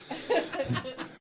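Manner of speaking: quick high giggle; a short airy laugh at himself; high light laugh. Real, not acted. Laughs at his own joke.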